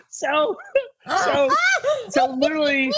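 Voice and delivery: high laughing restart. Real, not acted. Guest tries to resume story, high laughing. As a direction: try to resume while cracking up. Laughing bursts after joke.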